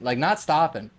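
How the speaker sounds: normal talking voice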